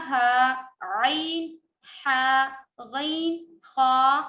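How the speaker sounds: ہا عین ہا غین خا drawn out slowly; name each throat letter slowly; slow letter naming. Shown as a demonstration. Teacher lists throat letters: slow, deliberate. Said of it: "حروف ہلکی کون کون سے ہیں".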